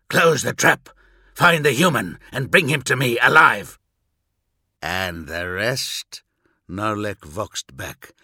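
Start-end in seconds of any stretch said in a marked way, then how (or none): 0.1-3.8 loud, urgent order over the vox; commanding shout. Acted. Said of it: "Narek hissed down the vox". 4.8-6.2 low slow eager voice. Acted. Eager soldier voxes back.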